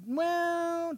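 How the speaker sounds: high, long drawn-out "well"; sceptical, sustained high hedge; doubting tempter. Acted. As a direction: stretch it out to cast doubt. Acting the devil sowing doubt.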